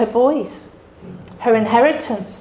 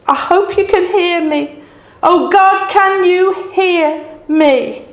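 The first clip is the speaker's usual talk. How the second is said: high, loud, slow desperate pleading; anguished crying out; 6 semitones higher, 4 dB louder, slower than usual. Retold or imitated. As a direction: call out to God with breaking grief. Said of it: "shouting at the birds and the animals".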